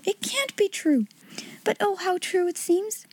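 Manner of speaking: high, soft, breathless disbelief; a little girl who can't believe her luck; a young girl's hushed, amazed denial; overwhelmed girl. Acted. Sarah's voice, overwhelmed.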